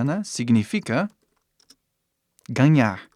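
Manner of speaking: ordinary delivery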